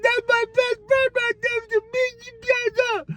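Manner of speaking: squeaky impression of an excited wrestler; Kyle Fletcher. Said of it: "He's like".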